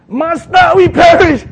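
high, loud desperate plea; panicked cry. Acted. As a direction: yell for help like a sinking fisherman. Disciples cry out in the storm.

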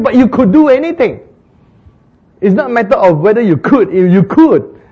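high, loud, insistent protest. Retold or imitated. Hagin protesting to Jesus in vision, loud high insistence. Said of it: "He said".

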